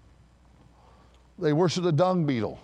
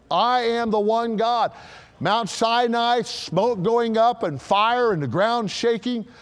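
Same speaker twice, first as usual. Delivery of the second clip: full-force preaching; 6 semitones higher, 4 dB louder than usual. Acted. God declaring himself the one God, thundering proclamation. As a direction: preach it high and emphatic, drawing out the words.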